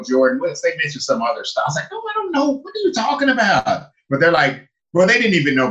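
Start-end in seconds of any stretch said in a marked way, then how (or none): 1.9-3.9 high-pitched, incredulous comeback; mock-bewildered protest in a high voice; baffled retort. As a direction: retell a bewildered reply, voice pitched way up. Baffled reply to strangers' questions.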